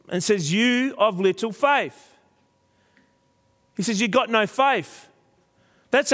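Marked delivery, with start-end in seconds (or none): 0.5-2.2 high, slow scolding of frightened disciples; Jesus scolding his fearful disciples, stern and slow; stern rebuke. Retold or imitated. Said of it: "The language is very, very strong". 4.0-5.1 high, firm scolding; stern rebuke. Retold or imitated. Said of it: "The language is very, very strong".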